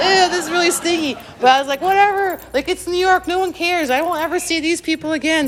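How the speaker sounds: high, squeaky mock disgust then shrugging it off; mock disgusted inner voice. Inner voice, stinky napkin.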